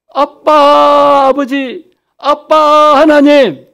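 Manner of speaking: high, loud, drawn-out call to a father. Shown as a demonstration. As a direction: cry out to God for help, stretching the words. Demonstrates calling on God for help. (높고 크게 길게 부르짖기)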